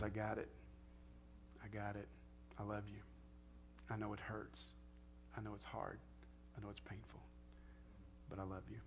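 soft, tender reassurance; God's comfort. Acted. God comforting a hurting believer, softly and tenderly. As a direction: hold them gently with each word. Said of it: "and he says".

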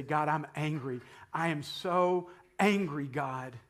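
loud, rising anguished cry; angry prayer. Pretend. Praying person admitting anger to God, loud cry. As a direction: let the anger break out to God. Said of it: "I can say".

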